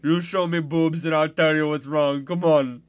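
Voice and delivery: put-on loud, high Indian-accented pleading; hammed-up Indian patient wheedling his therapist. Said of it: "it's an Indian guy".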